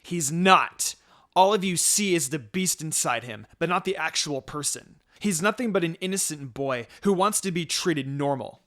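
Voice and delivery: loud, angry defense of her brother; furious sister yelling at the traitor; yelled. A narrator's reading. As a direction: shout him down. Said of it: "Minako yelled".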